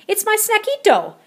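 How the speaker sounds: high, loud proud kitty voice. A narrator's reading. Scopito explaining his banana, high and loud. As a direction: answer it proudly. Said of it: "replied the gatito".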